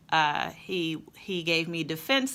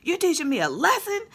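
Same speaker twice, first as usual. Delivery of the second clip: much higher and louder, whiny protest; son's outraged whine; 12 semitones higher, 6 dB louder than usual. Retold or imitated. Son protesting the lesson to mom, loud high whine. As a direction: wail it like a wronged, hungry kid. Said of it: "And he".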